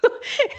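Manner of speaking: short unvoiced laugh of embarrassment; quick laugh. Real, not acted. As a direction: let out a quick breathy giggle. Laughs at own silly habit. (risadinha rápida e sem voz)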